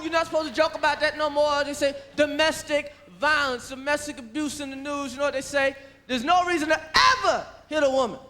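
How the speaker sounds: normal talking voice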